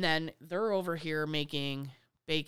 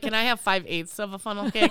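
louder picky request; fussy customer; 3 semitones higher, 7 dB louder than usual. Retold or imitated. Customer pestering the vendor, louder picky request. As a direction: ask like an annoying customer. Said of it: "They're like".

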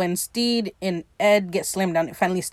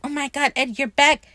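higher, mock-smitten surprise; lightly gushing, pitched up; retold delighted surprise; 6 semitones higher than usual. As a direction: act out a delighted welcome back.

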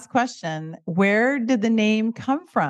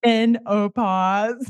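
drawn-out punchline; 3 semitones higher, much slower than usual. Acted. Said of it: "wordplay punchline".

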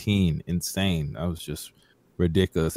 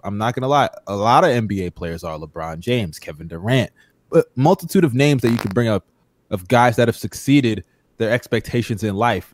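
ordinary talk, nothing marked

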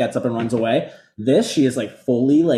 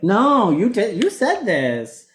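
higher, slow, insistent mock Mary; imitating Mary; 8 semitones higher, much slower than usual. Cohost imitating Mary calling Meredith out, slow and insistent. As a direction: lean on each word like Mary calling her out. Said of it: "Mary is like".

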